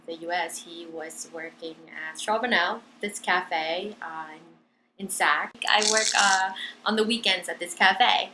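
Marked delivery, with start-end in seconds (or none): none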